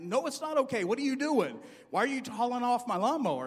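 high, loud protest; indignant yell. Retold or imitated. Husband protesting to his wife about his lawnmower, loud. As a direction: refuse it, voice up and loud.

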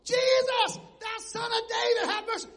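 high, loud desperate cry; hollering. Retold or imitated. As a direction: cry out to Jesus for mercy. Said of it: "was hollering".

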